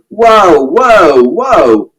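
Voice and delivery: loud high call fading down; shouted whoa. A narrator's reading. Mister Shears shouting to stop the fight. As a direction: rein them both in. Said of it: "And Mister Shears shouted".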